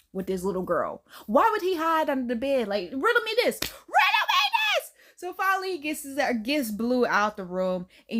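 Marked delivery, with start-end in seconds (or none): 2.9-3.6 indignant challenge. Real, not acted. Reviewer challenging the movie's logic. 3.6-4.9 very high, squealed challenge; comically outraged squeal; indignant outburst. Real, not acted. Repeats challenge in exasperated outburst.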